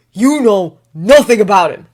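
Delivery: loud furious shout. Acted. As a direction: yell it at him. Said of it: "Harry shouted".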